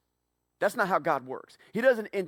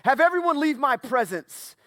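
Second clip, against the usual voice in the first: loud commanding cry, higher; cried out; 9 semitones higher, 8 dB louder than usual. A narrator's reading. Joseph ordering attendants out, a loud cry. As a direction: shout it to clear the room. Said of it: "he cried out".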